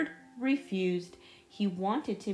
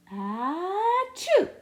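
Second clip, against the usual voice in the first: loud high acted sneeze; booming cartoon sneeze; big sneeze; 10 semitones higher, 6 dB louder than usual. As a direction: let out a huge theatrical achoo. Clifford sneezes in story.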